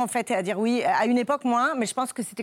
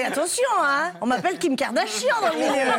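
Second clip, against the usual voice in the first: mock boastful; 8 semitones higher, 3 dB louder than usual. Joking boast about her archives.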